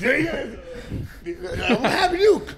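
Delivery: very slow, high, drawn out through laughter; old schoolmates amused at his arrest; laughing question. Retold or imitated. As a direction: stretch it out while cracking up. Classmates' amused question, retold.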